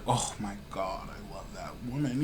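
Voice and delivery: very soft, low-pitched lovestruck quote; smitten murmur. Retold or imitated. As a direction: hush it like a private swoon over someone. Voices smitten man recalling a girl.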